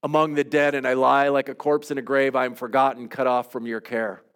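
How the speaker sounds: praying a psalm of lament aloud; heartfelt prayer. Acted. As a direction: pour out a lament before God.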